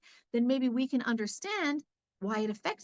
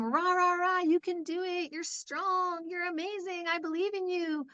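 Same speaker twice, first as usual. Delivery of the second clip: high pep-rally cheering; mock cheerleading; 7 semitones higher than usual. Parenting book cheering on a timid child, mock cheer. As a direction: cheer them on, pitched up and bright. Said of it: "Tell them".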